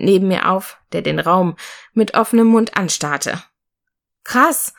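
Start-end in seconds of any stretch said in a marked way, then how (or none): 4.2-4.7 much higher, thrilled exclamation; a lightly voiced girl bursting with delight; excited call. A narrator's reading. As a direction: call it out, amazed. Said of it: "rief sie".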